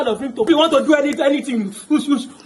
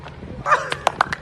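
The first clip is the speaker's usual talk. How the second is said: high unvoiced laugh; breathy laugh; 11 semitones higher, 5 dB quieter than usual. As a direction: wheeze with laughter.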